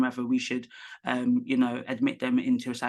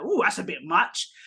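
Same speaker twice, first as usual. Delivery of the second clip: mock disapproval; 6 semitones higher than usual. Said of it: "another person might be like".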